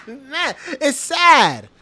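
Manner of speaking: high, drawn-out words through laughter; laughing lament. Real, not acted. Host reacting to witness's evasion, laughing lament. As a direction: still laughing, slow down and lament.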